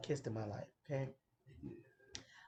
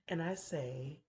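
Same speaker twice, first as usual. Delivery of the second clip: ordinary talk, nothing marked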